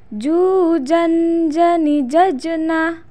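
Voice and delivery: chanted teaching model; swung, held syllables. Shown as a demonstration. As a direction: stretch and hold, bouncing the closed j. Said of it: "ayu ayun mana tahan".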